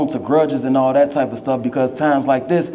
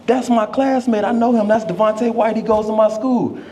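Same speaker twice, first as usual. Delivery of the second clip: put-on proud excited girl; 7 semitones higher than usual. Girl proudly telling party guests.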